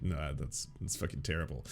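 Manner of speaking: low, quiet mock dismissal; muted rejection. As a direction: turn the pitch down under your breath, low and soft. Acting out reaction to the pitch.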